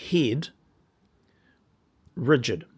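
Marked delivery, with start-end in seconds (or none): none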